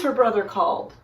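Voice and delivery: ordinary delivery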